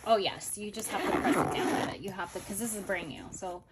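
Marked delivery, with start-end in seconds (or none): none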